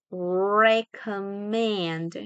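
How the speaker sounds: "Recommend" stretched syllable by syllable; very slow teaching pronunciation of "Recommend"; slow model. Shown as a demonstration. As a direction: draw the word right out. Teacher models word slowly.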